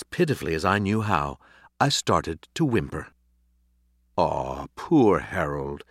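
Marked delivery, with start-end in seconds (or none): 4.2-5.8 slow, lower, tender pity; drawn-out, low "aw, poor thing"; quiet sympathy. A narrator's reading. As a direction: soothe a whimpering dog. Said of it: "Mister Monroe said quietly".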